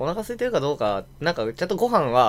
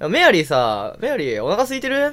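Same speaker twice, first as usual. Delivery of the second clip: a light take on the noble talking to Mary in plain Japanese; lightly voiced, chatty question; mock friendly Japanese; 5 semitones higher than usual. Friend stubbornly asks Mary in Japanese, lightly acted. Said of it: "みたいな感じで".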